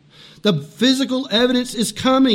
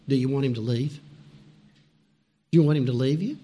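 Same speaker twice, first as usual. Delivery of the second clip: ordinary talk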